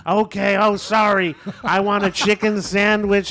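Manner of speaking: slow, high, loud ordering holler; drive-through yelling. Retold or imitated. Friend yelling his order at a drive-through speaker, slow mock yell. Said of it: "yelling at the thing".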